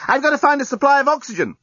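high-pitched urgent call; shouting after someone. A narrator's reading. Lucy follows journalist down corridor, shouting urgently. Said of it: "Lucy shouted after him".

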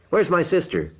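high, urgent yell. A narrator's reading. Narrator bursting in on Galvin, yelling high and urgent. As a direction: shout the question up the room in panic. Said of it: "I yelled".

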